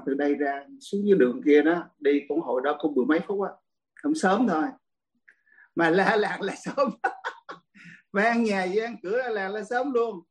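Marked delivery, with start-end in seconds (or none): 5.8-8.1 drawn-out words breaking into a laugh; amused, laughing storyteller. Real, not acted. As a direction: laugh while telling it, slowly. Laughs recalling his childhood yelling.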